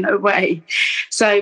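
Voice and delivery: ordinary talk, nothing marked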